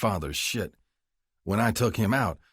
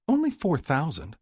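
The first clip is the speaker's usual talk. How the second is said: higher, coaxing mother; 8 semitones higher than usual. Acted. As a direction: play it down. Mother names sum.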